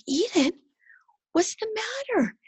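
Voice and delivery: put-on high, tender concern for a child; retold motherly worry. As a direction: ask a crying child in a high voice. Mother retelling concern for crying daughter.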